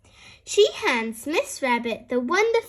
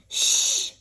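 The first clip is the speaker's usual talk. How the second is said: breathy shush; library shush, whispered. Acted. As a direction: hush them in the library. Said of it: "whispers Daddy Pig".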